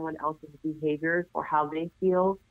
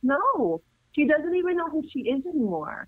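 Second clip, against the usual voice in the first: higher, insistent "No, she doesn't even know who she is anymore" with stress on "even"; emphatic rebuttal; 9 semitones higher than usual. Retold or imitated. Niece answering her aunt, in a pointed, higher voice. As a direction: lean on "even", firm and knowing.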